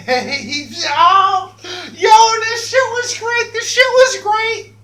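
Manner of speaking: laughing hard. Real, not acted. Cracking up telling friends how the prank went. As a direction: cackle through the words. Laughing at his own story.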